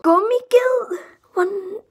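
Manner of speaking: high indignant cry of Gummiged; outraged yelp. Real, not acted. Youtuber misnamed by a viewer, shrieking indignantly. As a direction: shriek the name in disbelief.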